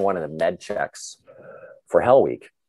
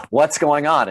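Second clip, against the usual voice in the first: high, loud mock bewilderment; bewildered trainee; 7 semitones higher, 6 dB louder, slower than usual. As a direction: imitate a baffled recruit, pitched up and louder. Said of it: "And you're like".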